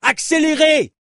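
high, irritated shout; cursing impatience. Acted. Cyclist spitting sand at the long-winded journalist, impatient shout. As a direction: yell at him to get on with it. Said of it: "pesta Cléo en crachant le sable".

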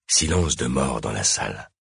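ordinary delivery

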